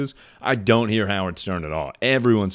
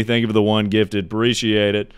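ordinary talk, nothing marked

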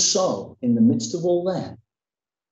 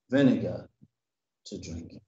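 fading; 9 semitones lower, 5 dB quieter than usual. Acted. Reading scripture, fading.

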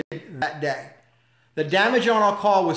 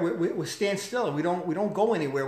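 normal talking voice

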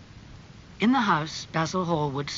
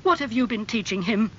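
raised, accusing question; acted indignant demand; angry; 7 semitones higher, 5 dB louder than usual. A narrator's reading. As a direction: confront the bad influence, louder and higher. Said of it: "he asked angrily".

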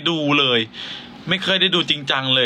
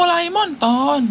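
much higher cartoon robot-cat voice; Doraemon voice; 14 semitones higher than usual. Shown as a demonstration. Host attempting Doraemon, high and cartoonish. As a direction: try the squeaky Doraemon pitch. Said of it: "โดราเอมอนทำไม่เป็น".

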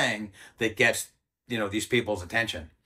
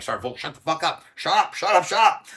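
a hint of the major, rising higher and louder; urgent hush-up; 6 semitones higher, 3 dB louder than usual. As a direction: cut him off fast, insisting. Major silencing him, grabbing his arm.

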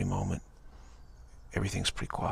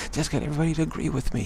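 put-on high, fast and louder kid voice; a kid announcing his plan, high, fast and loud; excited kid; 8 semitones higher, 5 dB louder, faster than usual. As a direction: rattle it off like a gleeful child. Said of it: "a kid saying".

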